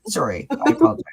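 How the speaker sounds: high, loud, giggly apology; breaking into laughter. Real, not acted. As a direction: lose it laughing while trying to be serious. Breaks into giggles while trying serious.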